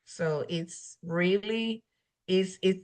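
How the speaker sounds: usual speaking voice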